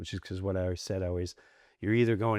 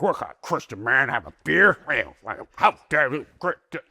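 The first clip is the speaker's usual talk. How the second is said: mock indignant; 3 semitones higher, 6 dB louder than usual. Churchgoers scolding him for drinking.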